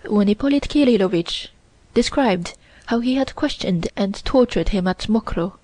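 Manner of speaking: plain reading voice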